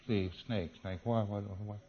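very soft, halting murmur; hushed, stumbling thinking aloud; groping for recall. Acted. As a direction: act out searching memory under your breath. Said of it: "P C says".

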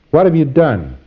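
pressing auditor question; firm auditor question. Shown as a demonstration. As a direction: ask it squarely, pressing. Demo auditor asking question.

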